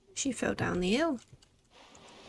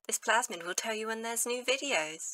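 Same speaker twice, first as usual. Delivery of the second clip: mock announcer; 3 semitones higher, 3 dB quieter than usual. The player reads a mock subscribe reminder to viewers, announcer style. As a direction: parody public announcement, soft and high.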